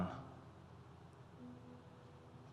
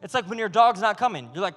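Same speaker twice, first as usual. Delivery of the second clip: ordinary delivery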